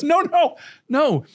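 laughing no. Retold or imitated. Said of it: "And there goes".

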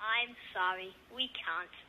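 cold quiet refusal. Acted. Doctor refusing medicine to a poor mother, cold and quiet. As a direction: say it coldly under your breath.

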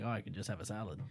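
soft, deflated mumble; mock quiet sigh. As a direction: sigh it out, resigned and hushed. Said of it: "and I'm like".

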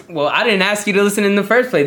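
high-pitched, indignant comeback; defensive retort. Retold or imitated. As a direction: push back at an uninvited critic. Retelling his retort to a critic.

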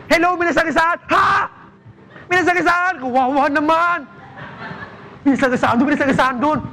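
high excited mock panic, shouting the news; hammed-up frantic caller; overreacting caller crying for help. Panicked caller, "react team.

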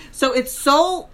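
normal talking voice

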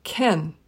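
can said weak as kn; unstressed can, vowel almost gone; weak form can, quick and light; reduced can. Shown as a demonstration. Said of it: "usually pronounced in a sentence as".

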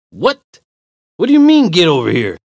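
high, loud disbelief; indignant. Acted. Walker turns on the stranger, indignant. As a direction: throw his order back at him.

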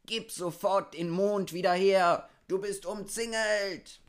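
high, full-voiced siege shout; shouted demand. A narrator's reading. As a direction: yell the ultimatum at the hunter. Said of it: "schrien gleichzeitig". (laut geschriene, hohe Forderung)

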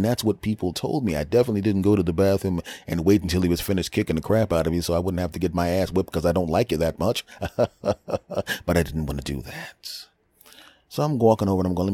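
ordinary delivery

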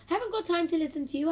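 high, loud, fast huffy rebuff; impatient huff. A narrator's reading. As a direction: huff it out in a rush. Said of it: "huffed Emily".

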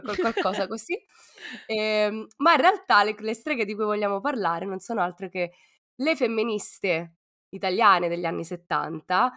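usual speaking voice

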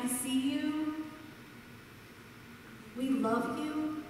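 soft and slow; speaking warmly to the parents; tender. Acted. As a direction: reassure the parents gently.

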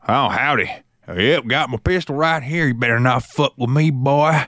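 slow Texan cowboy caricature; Big Tex, Texan drawl. Acted. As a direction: drawl a swaggering warning like a mock Texan. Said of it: "see Big Tex in his fucking boots".

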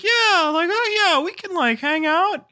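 put-on the timid kid warming up to the plan; eager kid voice. Shy boy agreeing.

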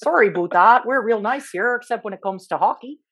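exaggerated northern Canadian-Minnesotan accent. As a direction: apologise like a friendly mock Canadian. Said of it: "like Minnesotan or".

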